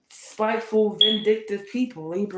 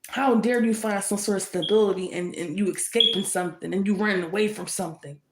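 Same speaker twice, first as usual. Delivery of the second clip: ordinary delivery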